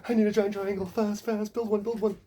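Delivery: high and loud, a panicked pharaoh on a bad trip; frantic high yelp. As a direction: shriek orders in a coked-up panic. He's bad tripping.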